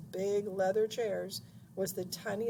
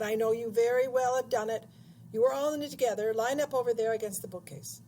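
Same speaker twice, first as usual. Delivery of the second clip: loud, driving accusation, raised pitch; angry headmaster; 4 semitones higher, 8 dB louder, faster than usual. A narrator's reading. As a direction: dress the boys down loudly. Headmaster accuses and orders boys.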